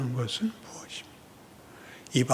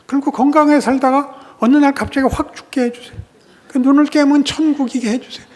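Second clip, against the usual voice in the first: mocking the prayers; 11 semitones higher, 8 dB louder than usual. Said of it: "자꾸 해달래잖냐".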